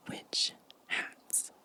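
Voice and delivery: normal talking voice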